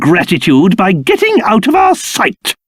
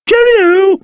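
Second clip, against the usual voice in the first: cheery farewell; 11 semitones higher than usual. Acted. Calls a farewell.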